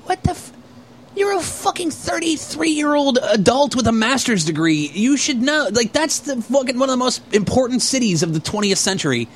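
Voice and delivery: high-pitched incredulous scolding; retold exasperation. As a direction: lecture an idiot in mock disbelief.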